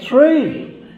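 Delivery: sudden high, loud "Three."; surprised correction. Real, not acted. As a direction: blurt it out, delighted. Correcting the count of Margarets.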